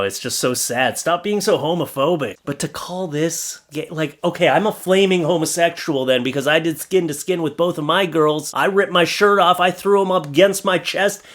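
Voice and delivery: ordinary delivery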